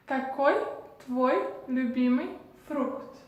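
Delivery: "Какой твой любимый фрукт" in separated words, ka-KOY tvoy lyu-BEE-miy frookt; Russian demonstration. Shown as a demonstration. Teacher repeating the question after explaining it, Russian demonstration. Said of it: "le dire en russe".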